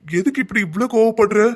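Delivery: sharp angry shout. Acted. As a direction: bark at her to stay put, then scold. Husband stops angry wife leaving.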